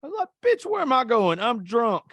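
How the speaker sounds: usual speaking voice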